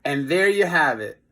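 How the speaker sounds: ordinary delivery, nothing marked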